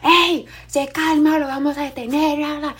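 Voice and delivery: high-pitched mock shouted order; police officer shouting. Police officer yells at an agitated person, mock shouting. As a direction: imitate a cop yelling to take control. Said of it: "le va a comenzar a gritar".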